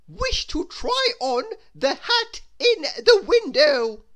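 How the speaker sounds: very high, loud, lofty posh voice; haughty grand lady. Acted. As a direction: sweep the word up like a snooty lady. Miss Splendid's grand voice.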